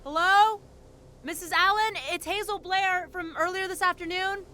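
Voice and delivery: loud, high call across the yard; calling loudly for the wife, unsure anyone's there. Acted. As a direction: project your voice toward the empty house. Calling toward an empty farmhouse.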